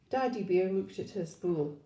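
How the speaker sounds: plain reading voice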